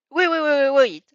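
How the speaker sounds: loud, high string of waits; urgent interruption. A narrator's reading. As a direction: cut them off, arms crossed in an X. Mina interrupting the conversation.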